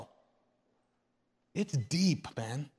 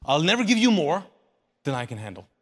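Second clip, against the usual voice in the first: loud first half, dropping low on "than I can handle"; emphatic promise; 3 semitones lower, 4 dB louder, faster than usual. Acted. Jesus insisting on his promise to his people, emphatic. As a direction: drive "I'll never give you more" out firmly. Preacher repeats the promise forcefully.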